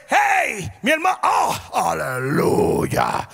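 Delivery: hammed-up churchy hello dragging the last word; fake cheerful church greeting. Saludo alegre fingido en iglesia. (saludo de iglesia exagerado y falso)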